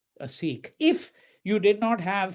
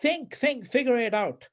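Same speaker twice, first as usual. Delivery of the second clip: mocking; 5 semitones higher than usual. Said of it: "So he's mocking him".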